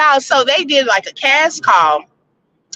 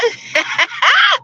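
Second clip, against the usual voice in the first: high laugh; 12 semitones higher than usual. Real, not acted. Laughs at a vote.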